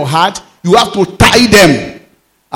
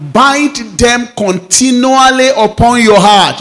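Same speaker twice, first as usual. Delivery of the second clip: higher, louder, slow proclaimed verse; preacher proclaiming the verse to the church, higher and louder; emphatic scripture reading; 6 semitones higher, 4 dB louder than usual. Acted. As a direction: proclaim it slowly and boldly.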